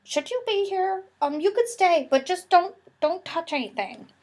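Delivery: high, wary teacher voice; worried teacher. Retold or imitated. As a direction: play a teacher nervous about catching the germs. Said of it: "they went".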